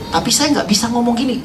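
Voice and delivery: ordinary talk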